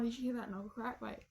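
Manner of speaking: usual speaking voice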